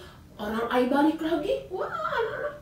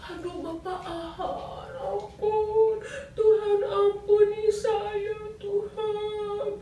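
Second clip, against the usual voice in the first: crying plea; 11 semitones higher, slower than usual. Acted. Achan begs God for mercy while stoned, wailing. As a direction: wail for mercy.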